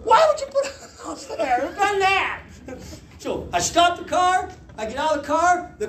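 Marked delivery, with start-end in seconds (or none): 0.0-2.4 laughing exasperation. Real, not acted. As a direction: wail it in mock despair.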